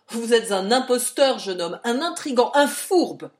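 loud, mounting accusations; furious captain exposing an impostor; angry denunciation. A narrator's reading. Captain hurls accusations at hero.

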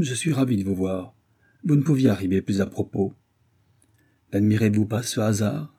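ordinary delivery

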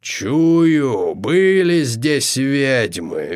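very slow, drawn-out oracle monster; prophesying creature. A narrator's reading. Creature sniffing out the witches' trail, intoning slowly. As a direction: intone each word with long vowels. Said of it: "вещало существо".

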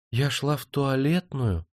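put-on high, slow little-girl innocence; childishly naive babble. A narrator's reading. Caught woman explains herself, babbling with childlike innocence. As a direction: play innocent in a drawn-out childlike voice. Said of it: "детски наивно пролепетала она".

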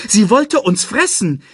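high, loud indignant protest; snorting indignation. A narrator's reading. Timon objects to Nala as friend, indignant. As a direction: protest in outrage at the introduction. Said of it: "schnaubte Timon".

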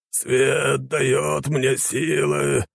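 very slow, high-pitched chant; old holy warrior droning high; dazed zealot. A narrator's reading. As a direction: drag out each word like a mantra. Old light warrior repeats his mantra.

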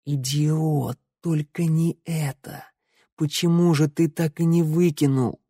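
high, hushed panicked self-reproach; dismayed inner voice. A narrator's reading. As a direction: think it aloud in dread. Тео's anguished thoughts as found out. (в отчаянии, про себя)

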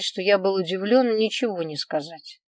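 ordinary delivery, nothing marked

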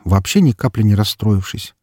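usual speaking voice

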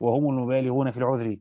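normal talking voice

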